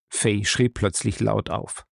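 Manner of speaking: ordinary delivery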